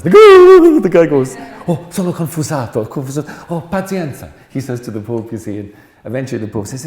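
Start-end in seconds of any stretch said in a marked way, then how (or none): 0.0-1.4 loud laughter. Real, not acted. Narrator bursts out laughing loudly. 1.7-4.3 a hint of a flustered Italian receptionist. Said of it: "a typical Italian again".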